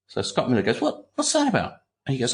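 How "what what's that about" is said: high-pitched, intrigued retort; puzzled question. Retold or imitated. Researcher asks the editor about the book, puzzled. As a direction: act out a baffled listener, pitched up.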